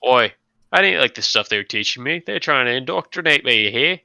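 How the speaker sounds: mock Aussie dropout, only half landed Australian; Australian accent. Doing a rough Australian accent for the dropout character. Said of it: "You really need to practice that, uh, accent".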